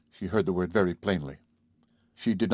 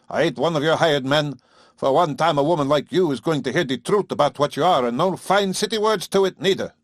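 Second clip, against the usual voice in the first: much higher, louder contemptuous farmer's tirade; sneering rant; 6 semitones higher, 4 dB louder than usual. Acted. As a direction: sneer the whole tirade at city women. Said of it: "and sneered".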